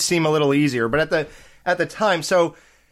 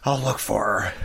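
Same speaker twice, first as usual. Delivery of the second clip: low, quiet, unfazed delivery; understated tough-guy impression; Seagal; 5 semitones lower, 5 dB quieter than usual. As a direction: say it low and soft, barely any effort. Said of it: "and Seagal's like".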